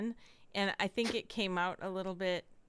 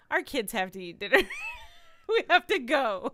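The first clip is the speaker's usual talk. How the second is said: laughing retold plea; 5 semitones higher, 8 dB louder than usual. Co-host retelling the guests begging to leave, laughing. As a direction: quote it through laughter, louder.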